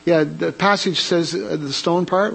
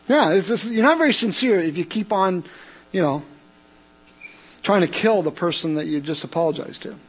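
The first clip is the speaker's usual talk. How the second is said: ordinary talk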